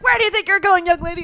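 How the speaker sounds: high, loud mock scolding; hammed-up strict teacher yelling. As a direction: shout at the kid storming out. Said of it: "She's like".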